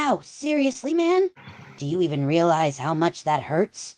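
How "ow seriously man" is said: pained yelp. Acted. Kicked in the groin.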